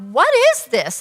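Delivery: high startled question; sudden high-pitched what is this; surprised. Retold or imitated. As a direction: ask it wide-eyed and shocked. Shocked question at the market.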